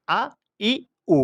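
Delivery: "আ, ই, উ" as three brief separate sounds; quick short vowels. Shown as a demonstration. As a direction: keep each of "আ, ই, উ" short. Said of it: "হরকতের উচ্চারণ তো হবে তাড়াতাড়ি".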